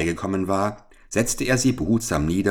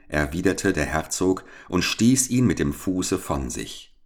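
ordinary delivery, nothing marked

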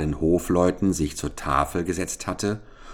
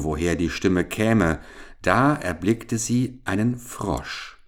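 ordinary delivery, nothing marked

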